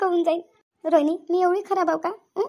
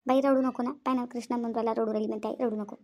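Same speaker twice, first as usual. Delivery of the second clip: softer, lower pleading not to cry; hushed urging; 6 semitones lower, 6 dB quieter than usual. Acted. Friend hushing the wife so her son won't hear, softly. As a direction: hush her before the boy notices.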